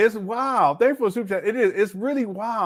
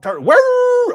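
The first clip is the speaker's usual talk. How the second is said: mocking whoop; 9 dB louder than usual. Real, not acted. Streamer mocking the clip's sex talk.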